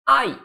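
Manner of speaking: "Ai" in one gliding syllable, weight on the A; unaccented diphthong. Shown as a demonstration. Teacher showing the word without accent, "Ai" as one gliding syllable. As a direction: keep "Ai" together as one syllable. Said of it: "Ai não tem acento".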